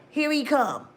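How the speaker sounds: ordinary talk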